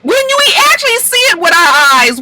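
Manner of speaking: very high-pitched, indignant shouting; outraged confrontation. Acted. Vlogger calling out a castmate's lies on the show, shrieky outrage. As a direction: cry out in disbelief at a blatant liar.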